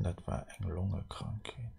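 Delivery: whisper. Real, not acted. Aside whispered.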